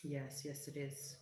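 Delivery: soft breathy whisper of craving. Real, not acted. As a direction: breathe it like a delicious secret. Reacting to food suggestion.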